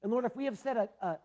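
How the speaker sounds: a pastor praying over his congregation, earnest and lifted; pastor's prayer. Real, not acted. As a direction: lead the church in prayer.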